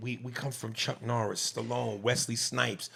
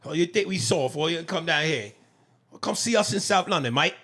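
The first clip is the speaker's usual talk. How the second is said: a put-on South London geezer voice, higher and louder; taunting London lad mocking accent; cheeky cockney challenge; mock British accent; 6 semitones higher, 4 dB louder than usual. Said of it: "make fun of the British actors".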